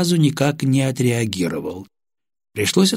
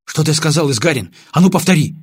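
urgent demand; 6 semitones higher, 5 dB louder than usual. Acted. Lieutenant demands repetition, alarmed.

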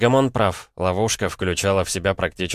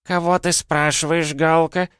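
put-on high old-woman voice chiming in; вторая бранящаяся старуха; 10 semitones higher than usual. A narrator's reading. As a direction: chime in as another scolding granny. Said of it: "ей вторил ещё один голос". (высокий голос старухи-подпевалы)

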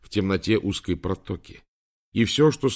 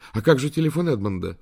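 higher-pitched, mildly anxious question; worried; 6 semitones higher, 4 dB louder than usual. A narrator's reading. Woman asking about their friend's phone, worried. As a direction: ask with a touch of alarm. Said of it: "встревоженно спросила Амбра".

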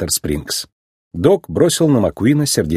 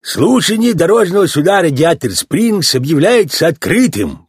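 higher, put-on voice of an official; sheriff opening court; 7 semitones higher than usual. A narrator's reading.

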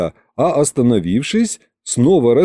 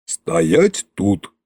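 низкий, негромкий приказ царя-злодея; коротко скомандуй, как властный государь; властный Кощей; 5 semitones lower than usual. A narrator's reading. Koschei commands his servants. (властный низкий голос)

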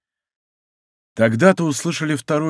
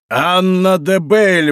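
high, ringing, drawn-out exclamation; восклицание; 6 semitones higher, 4 dB louder, much slower than usual. A narrator's reading. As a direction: cry the name out slowly, pitch raised. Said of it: "воскликнул монах". (громко воскликнув)

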